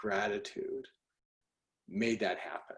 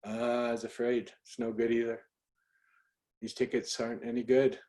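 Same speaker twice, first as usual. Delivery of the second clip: hushed imitation of an Irish doorman; Irish brogue; 7 dB quieter than usual. Irish ticket taker checking the second ticket, soft brogue. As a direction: break it softly in a light Irish accent. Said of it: "he's an Irish bro".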